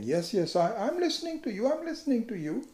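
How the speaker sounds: mock distracted yes, I'm listening; distracted listener. Imitating a distracted listener brushing someone off. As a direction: pretend to listen while glancing away.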